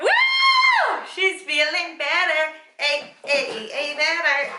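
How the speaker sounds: high, loud whoop of relief; relieved cheer. Real, not acted. Mother hearing her daughter settled, cheering loudly. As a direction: let the good news burst out. Relief after call to school.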